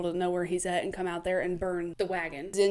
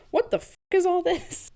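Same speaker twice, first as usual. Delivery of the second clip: higher, louder startled quote; mock shock; 10 semitones higher, 4 dB louder than usual. Contractor finding buried bodies, mock shock. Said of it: "contractors aren't like".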